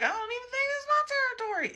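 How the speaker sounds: very high, fast, otherworldly deity backing away; a baffled divine voice, high and fast. Retold or imitated. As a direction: rattle it off high like a spirit passing the buck. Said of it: "the divine went over it a couple times, like".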